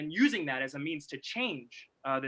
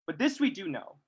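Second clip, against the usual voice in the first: solemn reading; 5 dB louder than usual. Acted. Speaker reads a Du Bois passage to the audience, solemn. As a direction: read it like scripture.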